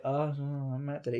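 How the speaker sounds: quiet, embarrassed mumble; sheepish mumble. Retold or imitated. Asked about his shaved head by friend, quiet sheepish mumble. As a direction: mumble it low, caught out. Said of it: "I was like".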